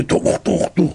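voiceless thudding stomps; imitated footsteps. King hears big shoes in the yard, imitated clomping. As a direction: mimic heavy shoes clomping.

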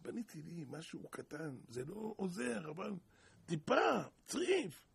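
stranded man's prayer turning to a wail; desperate prayer. Retold or imitated. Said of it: "התחיל לפנות הקדוש ברוך הוא".

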